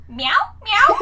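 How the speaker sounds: playful imitation of grandma meowing. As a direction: meow as a questioning attempt to be understood. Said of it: "her accent was distracting this".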